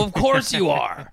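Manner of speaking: mock emphatic. Mocking the cops' logic.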